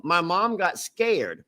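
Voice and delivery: ordinary delivery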